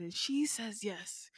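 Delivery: hushed, breathy aside; a secret whispered to a friend. Acted. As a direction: whisper it like juicy gossip. Gossip about a date reveal.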